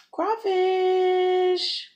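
high sing-song crawfish; excited drawn-out crawfish. Real, not acted.

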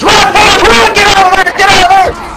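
very high, loud yelling to run; frantic shout. Retold or imitated. Yelling at Keith to run from the lit fuse, frantic shout. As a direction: scream at your friend to flee.

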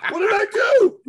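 high, loud comic panicked yell; mock panic. Man faced with a crying woman, mock-panicked. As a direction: play up the panic, half laughing. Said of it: "I panicked".

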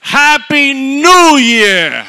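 huge, high, drawn-out shout; hammed-up countdown cheer. Preacher acting out the ball-drop crowd, long mock cheer. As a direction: bellow it slowly like a reveller.

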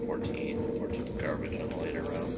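unvoiced whispered advice; whispering. Real, not acted. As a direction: whisper it to a teammate. Whispered advice during game.

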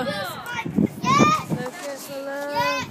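very slow, stretched words; drawn-out. Real, not acted. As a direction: drag every word out.

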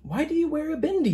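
put-on curious kids asking. Classmates quizzing the boy about his bindi, quick and curious. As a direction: rattle it off like a nosy classmate. Voicing curious listeners' question.

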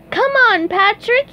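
high, loud cartoon yell; SpongeBob yelling. A narrator's reading. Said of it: "yelled SpongeBob".

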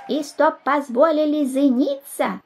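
high, slow thin squeak; squeaky little voice. A narrator's reading. Small boy demands leave to marry, squeaking. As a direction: pipe it up like a tiny child. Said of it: "пискнул тоненький голосок".

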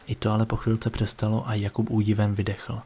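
ordinary delivery, nothing marked

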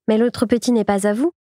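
usual speaking voice

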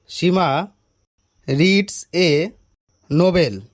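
halting, pauses between words; word-by-word repeat. Acted. Teacher types the question into his phone, halting. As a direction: say it piece by piece while typing.

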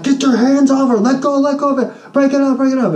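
high yelled commands to break up a fight; retold shout. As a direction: bark at the girls to let go.

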